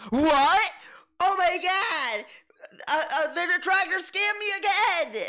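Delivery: high, loud panicked shriek; mock panicked old man. Old DSP panics in skit.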